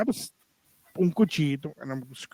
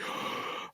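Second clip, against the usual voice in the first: sharp gasp; 14 dB quieter than usual. Retold or imitated. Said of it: "I'm like".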